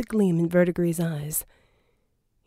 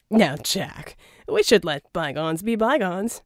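Verdigris voiced. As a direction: sell the deal, oily and grand. Verdigris pitching a deal.

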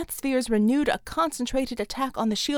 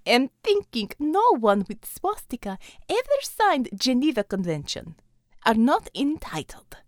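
a Russian commander speaking English; Russian accent. Acted. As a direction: lay on a thick Russian accent. Russian figure Xavier.

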